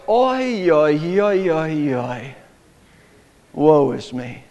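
mock lament, Oi drawn out and fading; wailing. Tevye in Fiddler on the Roof, mock wail. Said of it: "and then he wails".

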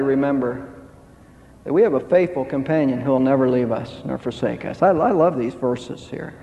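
ordinary talk, nothing marked

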